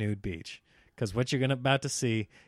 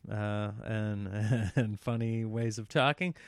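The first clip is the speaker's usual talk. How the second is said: ordinary talk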